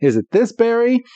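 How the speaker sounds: high-pitched little creature asking; mock small-creature voice; imitated Caterpie. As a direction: pitch it way up into a questioning lilt. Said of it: "Caterpie's like".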